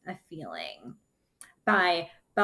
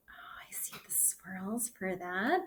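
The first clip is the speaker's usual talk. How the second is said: breathy whispered aside; soft hushed aside; 7 dB quieter than usual. Acted. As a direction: whisper it in delight to the kids. Aside while showing pictures.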